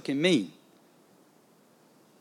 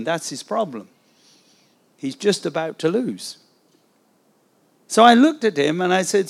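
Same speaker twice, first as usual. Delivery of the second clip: ordinary talk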